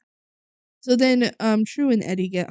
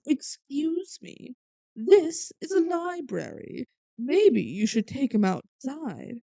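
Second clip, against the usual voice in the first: high, slow prissy librarian scolding; snooty librarian; 4 semitones higher, slower than usual. Retold or imitated. Librarian scolding the panicking kids, prissy and slow. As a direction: look down your nose at the noisy kids.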